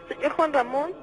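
panicked pleading, rising high and loud; terrified. Real, not acted. Caller begs the radio host for help, terrified. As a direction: beg for help as something closes in. Caller feels the shadows coming.

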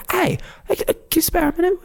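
high-pitched British reporter approaching someone. Acted. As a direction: act an old-time reporter asking for a moment. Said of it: "like a reporter".

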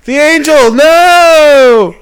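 long, high, full-volume wail; mock screaming. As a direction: scream it out in hammed-up anguish. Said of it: "You want Kim screaming".

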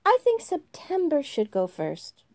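April proposing. Acted. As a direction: put September forward.

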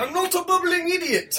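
high-pitched mimicked Irish; squeaky-high send-up of an Irish accent; mock Irish accent. Host mocking co-host's bit, high Irish accent.

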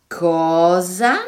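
loud, stretched, rising question; baffled shout. A narrator's reading. Children hearing the giant's strange language, baffled shout. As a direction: cry out in disbelief. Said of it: "gridarono i bambini".

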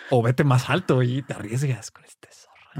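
slow hushed whisper; a secret tip, slowly whispered. Real, not acted. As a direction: whisper the hint so others can't hear. Whispering a hint during a game.

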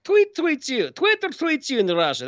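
put-on high, hammy Russian accent; bad Russian accent. Host joking that tweets tweet you, bad Russian accent. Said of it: "That was a bad Russian accent".

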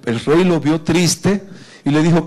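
usual speaking voice

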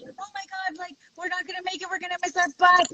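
high, hushed mock panic; light, high-pitched fretting; retold fretting. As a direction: imitate worried companions, high and soft. Retold worry of companions.

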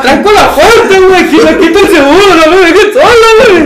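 high, panicky whine with nervous laughs; scared whining. Retold or imitated. Scared friend begs not to be left alone, panicky high whine. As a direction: beg like a terrified friend, half laughing. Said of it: "todo culón".

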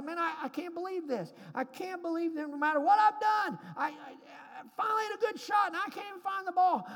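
frustrated outburst. Retold or imitated. Hacker shouting his disgust at the lost ball. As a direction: vent the frustration in a yell. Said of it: "He's mad. He's upset".

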